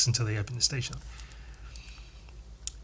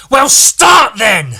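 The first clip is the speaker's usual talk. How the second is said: loud, high, drawn-out angry retort; furious retold shout, each word stretched; aggressive shout; 9 semitones higher, 12 dB louder, much slower than usual. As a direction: imitate an angry yell right in someone's face. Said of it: "She very aggressively replied".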